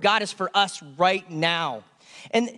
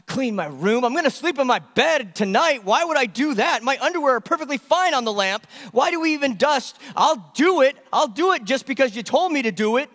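put-on higher, louder, whining teenager complaining about chores; whiny kid; 6 semitones higher, 4 dB louder than usual. Pastor mimics his kids griping about cleaning: high whiny complaint. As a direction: whine it like a put-upon kid refusing to clean. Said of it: "This is what happens in my house".

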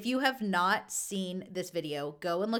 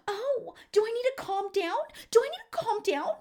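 high, sneering mock of calm down; 13 semitones higher than usual. Kidz Bop mom mocking the passenger, high and sneering. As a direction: mimic her mocking in a high voice. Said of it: "starts talking like that".

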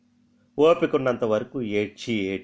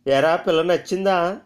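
put-on sneering old mother; 6 semitones higher, 5 dB louder than usual. Mother questions son about bride.

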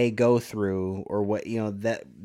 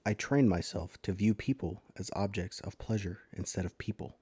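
Jeffrey Dahmer; 4 semitones lower, 9 dB quieter than usual. Acted. Podcaster showing a co-host the killer's voice, low emotionless monotone. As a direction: drone it quietly, drained of all emotion. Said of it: "That's my Jeffrey Dahmer".

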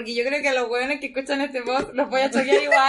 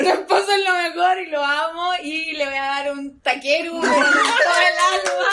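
laughing gush; 4 semitones higher, 5 dB louder than usual. Acted. Gushing laughing declaration to husband.

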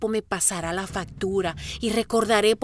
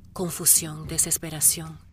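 normal talking voice

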